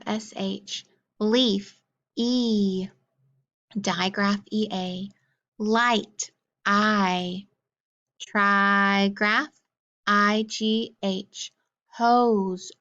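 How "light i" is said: ordinary talk, nothing marked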